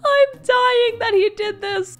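high, loud laughing cry; laughing squeal. Real, not acted. Fan dying of laughter, high and loud.

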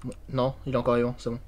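softly, hushed while hiding; whispering while hiding. Real, not acted. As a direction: keep it down so the ghost can't hear. Teammate hiding from ghost.

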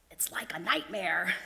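breathy sea urchin whisper; spooked whisper. A narrator's reading. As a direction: whisper it like a bad dream. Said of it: "cried the sea urchin".

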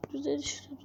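soft whispered praise. Acted. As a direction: whisper it softly. Quiet approval.

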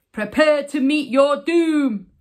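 loud, dramatic knightly battle cry; loud storybook threat from a tiny knight. A narrator's reading. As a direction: call out a bold, playful challenge. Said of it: "he cried".

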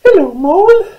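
very high, squeaky tiny mouse voice; little mouse. Acted. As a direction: exclaim in a loud mouse squeak. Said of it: "she said".

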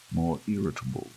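ordinary delivery, nothing marked

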